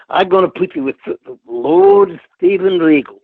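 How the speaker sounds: put-on lisp, slowing into a big announcement; Dusty Rhodes. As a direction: imitate the boss, stretching out the grand title. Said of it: "that's how he talks in his Dusty Rhodes voice".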